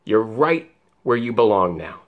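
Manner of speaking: usual speaking voice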